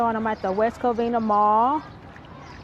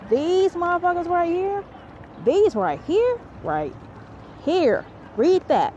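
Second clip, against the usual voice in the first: excited; 7 semitones higher, slower than usual. Real, not acted. Excited about favorite snack.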